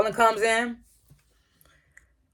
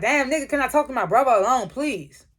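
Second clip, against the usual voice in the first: higher-pitched, mildly exasperated plea; retold irritation; 6 semitones higher than usual. Davis asking to be left with his brother, mock irritated. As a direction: imitate a fed-up brother asking for privacy. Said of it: "the way he said it to him".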